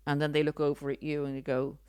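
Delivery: usual speaking voice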